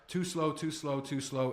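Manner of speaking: "too slow" dragged out and repeated. Shown as a demonstration. Coach warning cold callers about pace, dragging "too slow". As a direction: stretch each "too slow" a little. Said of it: "Speaking too slow, you sound dumb".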